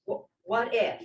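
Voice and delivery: ordinary talk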